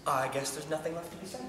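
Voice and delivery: soft, low admission; resigned. Acted. Husband accepting the divorce from his wife, resigned. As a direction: let it out quietly, giving up.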